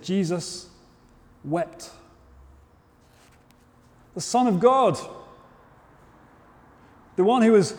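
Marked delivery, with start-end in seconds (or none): 0.0-1.9 soft, slow and hushed, letting each word sink in; almost whispering the verse; hushed reverence. Acted. Preacher reads the verse to his congregation in a hushed voice.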